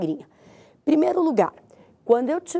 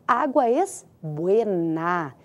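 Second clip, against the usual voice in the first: "agua es buena" very slowly, lingering on "buena"; slow, lower "agua es buena" with "buena" drawn out; drawn-out demonstration; 5 semitones lower, much slower than usual. Shown as a demonstration. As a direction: stretch out "buena" syllable by syllable. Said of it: "porque a gente fala".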